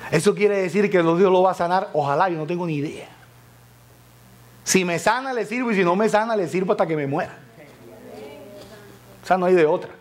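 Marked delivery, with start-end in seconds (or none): none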